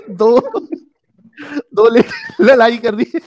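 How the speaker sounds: high, loud, through laughter; laughing. Real, not acted. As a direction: crack up while telling the joke. Jokes about the women's fight, laughing. (हँसते हुए ऊँची आवाज़ में)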